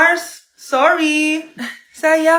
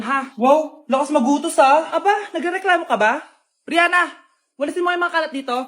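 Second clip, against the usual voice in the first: ordinary talk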